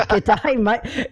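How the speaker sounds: mock Australian accent through amusement; Aussie accent. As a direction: echo a put-on Aussie greeting. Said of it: "I need to do an Aussie accent".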